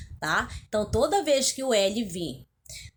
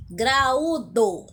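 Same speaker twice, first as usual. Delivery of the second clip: Graúdo with the Ú split from the A and pushed louder; stressed, separated U; 5 dB louder than usual. Shown as a demonstration. Teacher shows pupils the hiatus, Graúdo with a loud separate Ú. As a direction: say Graúdo, leaning hard on the middle Ú. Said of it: "o U está separado do A".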